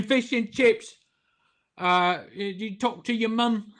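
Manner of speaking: high, slow put-on British accent; bad British accent. Joking with John about British theologians, bad British accent. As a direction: fumble a playful British accent. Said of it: "I, I can't do accents".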